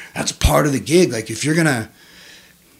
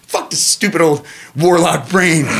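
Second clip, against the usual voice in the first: defiant; 5 semitones higher, 5 dB louder than usual. Acted. Acted defiant speech to himself.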